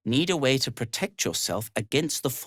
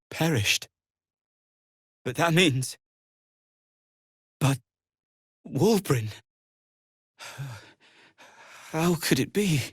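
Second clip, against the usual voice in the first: halting, broken-up disbelief; long pauses, voice catching on his friend's name; stunned grief. Acted. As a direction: fight to get the words out over the shock. Learns friend Wulbren has died.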